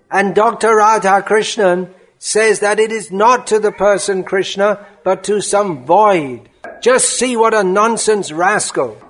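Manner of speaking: impassioned, indignant tirade; heated guru's rebuke, driving each point home; furious preaching. Acted. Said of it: "red and furious".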